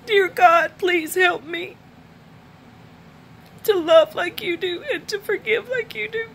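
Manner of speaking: praying through tears, pitched high; real tears, asking God for help; tearful prayer. Real, not acted. Praying aloud to God after the reading, crying. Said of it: "I'm sorry that I cry so much".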